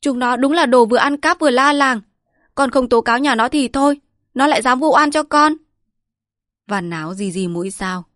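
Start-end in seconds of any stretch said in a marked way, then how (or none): 0.0-5.5 high, loud frantic denial; accused servant blames the neighbours, panicked and loud; panicked protest. Acted. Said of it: "Hắc Tiểu hoảng quá".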